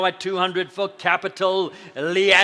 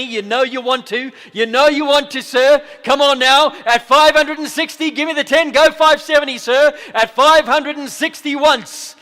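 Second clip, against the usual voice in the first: higher and louder, coaxing the last bid; excited auctioneer push; 7 semitones higher, 10 dB louder than usual. Real, not acted. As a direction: build the pressure, then slam it sold. Auctioneer pushing for final bid.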